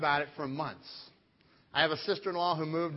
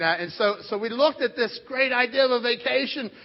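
normal talking voice

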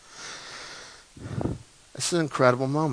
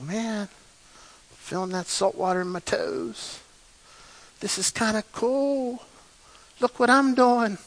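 carefree Peter; 6 semitones higher, 5 dB quieter than usual. Pretend. Imagined Peter enjoying walking on water.

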